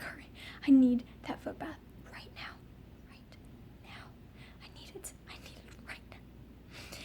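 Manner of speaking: desperate breathy whisper, fading to almost nothing; urgent whisper. Retold or imitated. Begging her mom for the foot bath, in an urgent whisper.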